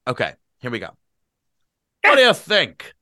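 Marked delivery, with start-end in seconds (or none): 2.0-2.9 put-on high, loud, eager drunk man; loud tipsy demand; impetuous demand. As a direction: fire the question at them excitedly. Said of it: "he demanded impetuously".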